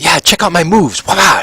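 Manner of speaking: put-on high, eager little boy voice; show-off kid. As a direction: show off proudly, ending in play-fight cries. Boy shows off his fighting moves.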